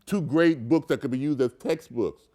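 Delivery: ordinary delivery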